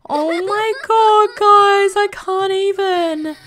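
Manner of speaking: high, slow, hammed-up sob voice; mock crying. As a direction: pretend to cry along with her. Mock overwhelmed at sad Sim.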